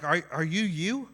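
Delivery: ordinary delivery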